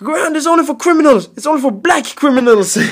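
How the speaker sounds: high, loud and fast angry lecture; angry rant. Retold or imitated. Angry uncle lectures nephew about Greyhound riders, loud. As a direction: scold him like a furious uncle. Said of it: "Så han blev skitarg".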